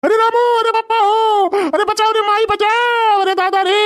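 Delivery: high, howling cries; comic old man yelling for rescue; wailing for help. Acted. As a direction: bawl for Ramu to save you. Old man beaten, calls for help.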